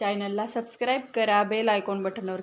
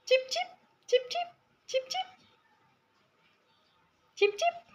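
very high, soft chip-chip chirps to call the sparrow; chirping bird call; 4 dB quieter than usual. Acted. Calling the pet sparrow like its mother, high soft chirps. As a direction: squeak like a mother sparrow calling her chick. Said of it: "आणि चिपचिप असा आवाज काढला".

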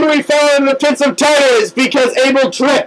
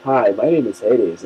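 much lower, soft, lazy devil voice; deep Hades voice; 5 dB quieter than usual. Pretend. Hades greeting the fallen friends, in a much lower, softer voice. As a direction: introduce yourself as the lord of the underworld, low and smooth.

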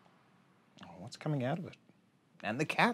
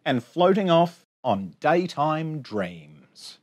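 bouncy sing-song rhyme reading; reading to children; 4 semitones higher, 7 dB louder, much slower than usual. Acted. Teacher reading a rhyming picture book to her class, sing-song. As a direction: read the rhymes out brightly for little kids.

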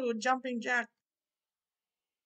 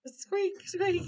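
high squeaky rabbit noise; bunny squeak; 7 semitones higher than usual. Acted. Baldy as a bunny squeaking, higher.

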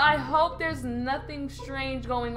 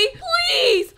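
desperate plea; 11 semitones higher, 4 dB louder than usual. Acted. Desperate begging.

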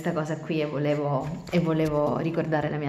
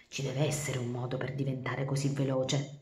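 softer, lower, thinking aloud; muttering to herself; 5 semitones lower, 7 dB quieter than usual. Acted. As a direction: say it to yourself. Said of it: "diceva tra sé e sé". (borbottando tra sé)

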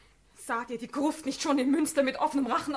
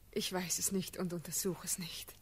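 barely voiced, soft and low; breathless whisper of a shy girl; 6 semitones lower, 9 dB quieter than usual. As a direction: hush it as if afraid to be overheard. Timid reply to the Elector.

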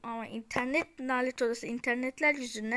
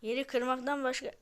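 ordinary talk

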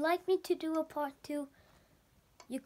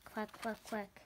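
soft quacks; 6 semitones lower, 11 dB quieter than usual. Acted. Duck slippers quacking.

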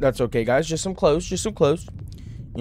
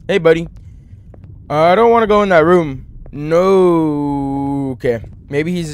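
normal talking voice

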